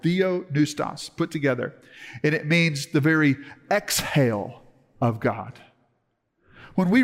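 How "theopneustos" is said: "theopneustos" said slowly, each syllable clear; "theopneustos" spoken carefully in Greek; Greek word said carefully. Shown as a demonstration. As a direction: sound out "theopneustos" deliberately. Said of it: "That word in the original language".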